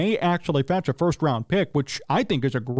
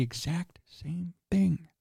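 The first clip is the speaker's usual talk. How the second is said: whispering a secret; 7 dB quieter, much slower than usual. Pretend. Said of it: "I'm whispering 'cause it's a secret".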